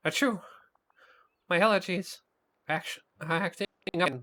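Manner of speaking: high, jittery monster voice; stressed cartoon monster voice. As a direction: fret like a nervous little monster. Mike stressed about Roz.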